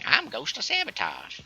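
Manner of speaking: hammed-up old prospector voice. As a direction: do a comic old prospector introducing himself. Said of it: "Get that old prospector voice going".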